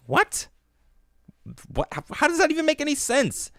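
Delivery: can't believe what you just heard; incredulous. Real, not acted. Incredulous reaction to quote.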